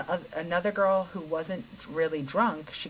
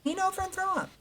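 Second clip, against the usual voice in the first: climbing ever higher, frantic pleading; 12 semitones higher, faster than usual. Retold or imitated. Daughter pleading with the sick girl in the back seat. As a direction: beg her with rising panic to use the window.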